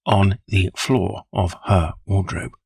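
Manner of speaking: slow, dazed, word by word; broken, halting words. Acted. Holmes, stunned by the clue, repeats it slowly. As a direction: let each word drop slowly, lost in thought. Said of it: "he repeated in broken words".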